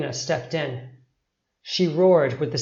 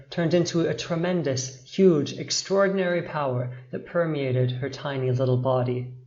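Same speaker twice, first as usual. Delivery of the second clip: ordinary delivery, nothing marked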